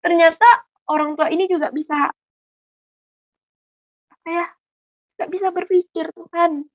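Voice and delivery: high, halting plea to God; retold sorrowful prayer. Lanjutan doa sedih sang ibu. (doa sedih bernada tinggi, tersendat)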